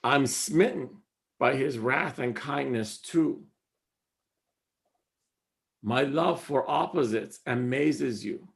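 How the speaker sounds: soft, measured reading of the couplet; quiet, devoted recitation; poem recital. Acted.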